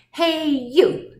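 loud, high hail; grouchy challenge. A narrator's reading. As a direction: call out like a scrappy ladybug. Grouchy ladybug accosts lobster.

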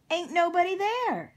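high, loud cowboy holler; loud protest. Acted. Cowboy missing Octopus's knock-knock joke, loudly protesting. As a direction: call it out, baffled and insistent.

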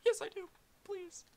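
very high, begging plea; pleading. Real, not acted. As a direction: beg in a squeaky voice. Begging for a weapon.

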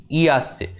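ordinary talk

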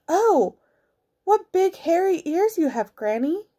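put-on high, astonished girl voice; wondering little girl. A narrator's reading. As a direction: marvel in a high child's voice.

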